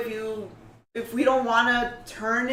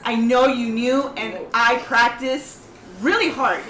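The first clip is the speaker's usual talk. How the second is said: louder, flustered insisting; loud defensive; 3 semitones higher, 8 dB louder than usual. Real, not acted. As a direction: push back loudly, embarrassed. Defensive reply to teasing.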